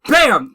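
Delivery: loud bam; punch sound effect. Retold or imitated. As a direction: smack it out. Imitated punch.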